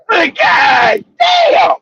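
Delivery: high, slow stretched cry; mock horrified wail at the mountain drop; shocked cry. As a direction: wail it like you nearly went over the edge. Almost drove off a mountain.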